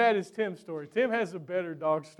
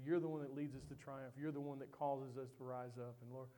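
soft, hushed prayer voice; closing prayer; 5 semitones lower, 11 dB quieter than usual. Acted. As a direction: pray quietly over the congregation.

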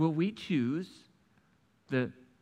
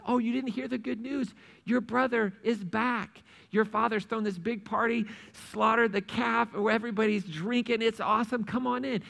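high, eager servant sharing good news; excited servant; 6 semitones higher than usual. Acted. Servant tells older son the news, excitedly. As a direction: bubble over about the party.

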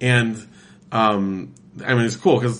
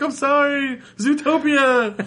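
laughing excuse; 13 semitones higher, slower than usual. Retold or imitated.

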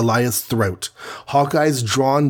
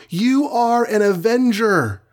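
high, desperate cry to a friend; desperate screech; 11 semitones higher than usual. A narrator's reading.

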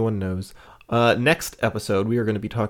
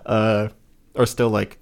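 usual speaking voice

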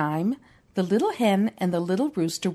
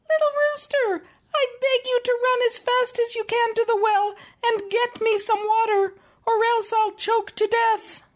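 much higher, pleading little hen voice; choking hen crying out; 14 semitones higher than usual. A narrator's reading. Mom reading Grimm, voicing the hen crying for water. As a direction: beg like a tiny hen about to choke. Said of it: "she cried out".

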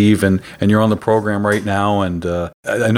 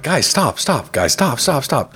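repeated stop; 3 semitones higher, slower than usual. Retold or imitated. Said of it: "I'm like".

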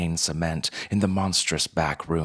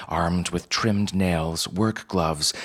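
plain reading voice

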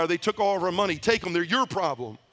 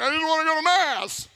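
high, loud, breaking plea; crying out in desperation; 10 semitones higher, 4 dB louder than usual. Retold or imitated. In the car, he begs the nun through tears, desperate. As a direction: cry it out like a man at the end of his rope. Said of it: "I was mad and crying".

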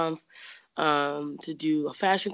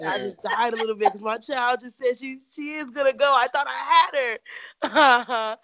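higher, laughing, slowing down at the end; laughing mom impression; 7 semitones higher than usual. Quoting her mom's reaction to her leaving, laughing. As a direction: play mom, heartbroken but giggling. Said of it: "she's like".

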